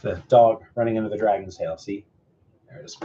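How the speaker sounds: plain reading voice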